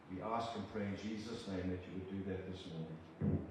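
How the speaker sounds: ordinary delivery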